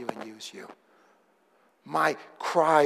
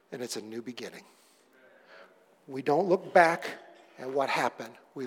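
soft sincere apology; 3 semitones lower, 5 dB quieter than usual. Real, not acted. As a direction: ask their forgiveness gently, almost hushed.

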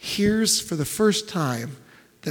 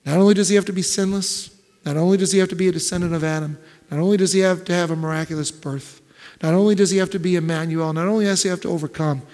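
fast, louder driving repetition; rapid-fire preaching; 4 dB louder, faster than usual. Acted. Preacher listing Christ's qualifications, fast driving build. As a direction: hammer each line out quickly.

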